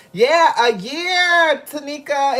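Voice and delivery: high, loud burst of excitement; bright shout of joy at the anniversary; excited. Real, not acted. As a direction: call it out to the chat, thrilled. Excited reply to viewer anniversary.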